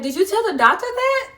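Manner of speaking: imitating mom's high, loud questioning; insistent mom. Mom questions daughter about telling the doctor, imitated insistent demand.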